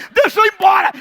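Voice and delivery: high, loud plea to leave; urgent shout. Retold or imitated. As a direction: beg to get away fast. Panicked wanting to leave. (grito apressado)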